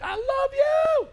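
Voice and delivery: high, drawn-out sing-song love; mock sweet I love you. Pastor mimicking a loving child, high and stretched. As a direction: swing way up, sugary and slow.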